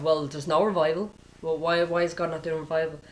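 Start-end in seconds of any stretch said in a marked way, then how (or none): none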